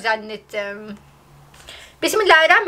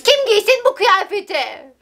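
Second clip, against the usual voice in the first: indignant protest; 7 semitones higher than usual. Real, not acted. Protests against comments on her outfit.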